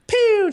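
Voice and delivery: high, loud cartoon "pew" gunshot noise; mock gunshot. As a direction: mimic a cheesy laser shot. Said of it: "But she's like".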